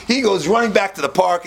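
ordinary talk, nothing marked

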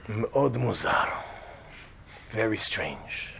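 low, hushed doctor voice; deadpan low Hebrew-speaking doctor; baffled specialist. Acted. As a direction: murmur it gravely, puzzled by the readings. Plays the baffled heart specialist.

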